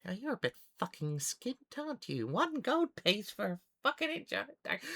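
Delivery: high, incredulous gnome shopkeeper; scoffing gnome. Acted. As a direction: balk at the offer in a squeaky voice. Gnome scoffs at low offer.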